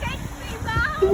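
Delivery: loud call for mom. Real, not acted. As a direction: shout for your mom. Child falls toward mom from tree.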